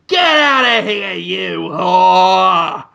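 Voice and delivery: loud, drawn-out bellow; mock outraged shout. Therapist throwing out his patient, mock outraged bellow. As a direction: bellow it in hammed-up disgust.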